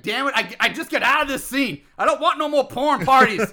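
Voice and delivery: mock exasperation; fed-up outburst. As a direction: mimic a man who's had enough. Acting out fed-up officer's outburst.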